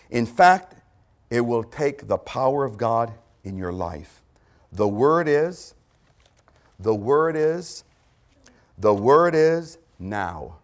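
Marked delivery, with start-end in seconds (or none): 4.7-10.6 slow suspenseful reveal. Acted. Dramatic pause before revealing word.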